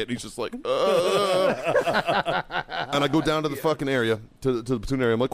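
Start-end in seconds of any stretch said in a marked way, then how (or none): 0.6-2.4 high drawn-out dopey groan; mock wail. Corporal thrown over a shoulder, mock helpless groan. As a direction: imitate a helpless high-pitched "uhhh".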